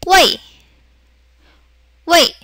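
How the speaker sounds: brief, dropping 웨이; fourth tone. Shown as a demonstration. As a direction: say 웨이 in Mandarin fourth tone. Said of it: "사성에서".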